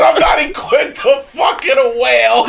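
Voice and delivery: high, slow mocking repeat through laughter; laughing quote. Friend quoting another friend while laughing. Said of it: "He said".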